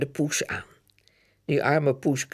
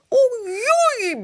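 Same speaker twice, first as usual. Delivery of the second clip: high, loud yelp of shock; yelling oei; 6 dB louder than usual. Acted. Soaked girl yells at her brother, loud and high. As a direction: cry out as cold water hits. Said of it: "brult Janneke".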